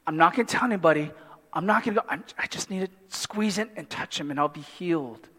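rushed, low private thought; inner thought. Retold or imitated. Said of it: "You're thinking".

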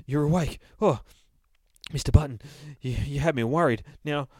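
fussy narrator character. Acted. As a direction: fret with relief, then press for a plan. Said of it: "says the narrator".

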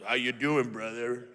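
put-on low arrogant voice; arrogant brother. Arrogant leader strolling up to the dishwasher, smug greeting. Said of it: "like, kinda arrogant".

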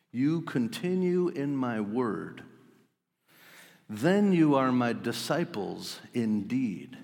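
slow, measured reading of the verse; slow scripture reading. Shown as a demonstration. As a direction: lay each word down slowly. First reading of Jesus' words.

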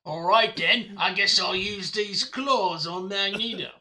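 drawn-out mock-Australian cartoon voice; slow-finishing, hammed-up Australian; Wolverine, Australian accent. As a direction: put on a hammy Australian accent, stretching the end. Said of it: "he had an Australian accent".

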